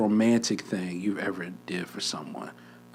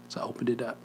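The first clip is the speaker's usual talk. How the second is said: ordinary talk, nothing marked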